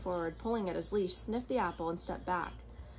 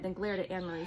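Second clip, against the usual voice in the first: ordinary delivery, nothing marked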